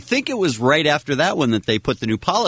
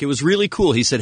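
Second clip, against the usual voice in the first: normal talking voice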